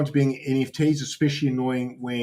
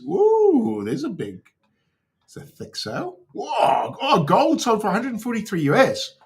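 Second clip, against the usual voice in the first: excited whoops; 11 semitones higher than usual. Real, not acted. Excited at a big sale.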